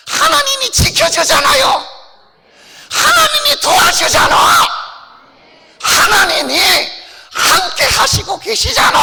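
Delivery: high, bold little puppet boasting. Acted. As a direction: brag proudly as the puppet doll. Puppet preaches and teases. (당당하게 자랑하는 높은 인형 목소리)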